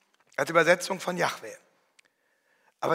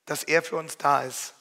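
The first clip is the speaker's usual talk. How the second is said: ordinary delivery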